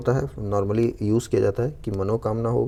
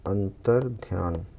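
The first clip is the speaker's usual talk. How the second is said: slow dictation; 3 dB quieter, slower than usual. Shown as a demonstration. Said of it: "शब्द है क्या है".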